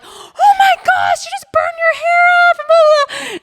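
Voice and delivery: hammed-up high squeal of alarm; freaking out. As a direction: shriek it like frantic friends. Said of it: "start freaking out".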